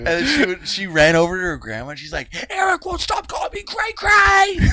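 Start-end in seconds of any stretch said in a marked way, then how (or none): 2.5-4.6 put-on very high little-girl screech; shrieking tattletale. Little girl telling on Eric to her grandma, mock shriek. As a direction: imitate a kid screeching to grandma.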